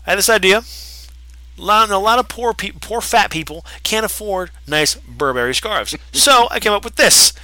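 high, cocky mock sales pitch; hammed-up smug wrestler voice; mocking MJF pitch. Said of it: "he goes up to Matt and Nick. It's just like".

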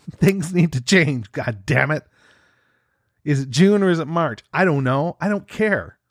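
fast, loud caffeine-fuelled rant; too much caffeine. Real, not acted. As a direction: rant high and wired, almost yelling. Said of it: "I am angry and I am wired on caffeine".

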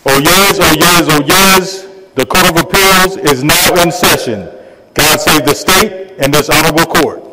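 booming formal court crier call; loud ceremonial proclamation; town crier. Real, not acted. Court marshal opens the session.

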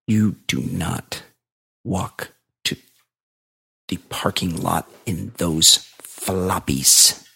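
slow, low warning, word by word; imitated grave warning. Shoe seller warns customer about his floppies, gravely. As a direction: spell it out slowly, as if it's dangerous. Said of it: "he's really freaked out that I'm gonna".

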